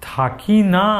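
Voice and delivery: ordinary delivery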